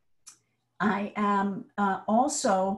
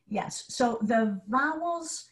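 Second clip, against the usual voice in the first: ordinary talk, nothing marked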